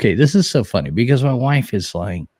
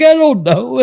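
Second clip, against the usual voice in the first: high, loud helpless squeak; imitating wife; 13 semitones higher, 6 dB louder than usual. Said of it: "She's like".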